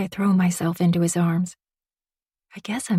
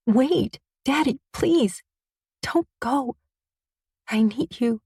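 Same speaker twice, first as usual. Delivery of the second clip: higher, slow begging for Daddy; pleading; 6 semitones higher, slower than usual. A narrator's reading. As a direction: beg him to stay, higher. Daughter begs father not to leave.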